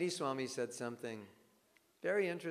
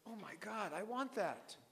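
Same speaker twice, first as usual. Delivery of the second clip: awed wanting; 5 semitones higher, 7 dB quieter than usual. Retold or imitated. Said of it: "they're gonna look at you and go".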